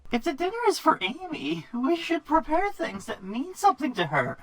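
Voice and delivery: high, loud squeaky sprite voice; Pixie voice. Acted. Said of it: "I love doing Pixie's voice".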